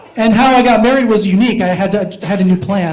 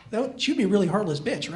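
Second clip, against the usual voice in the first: normal talking voice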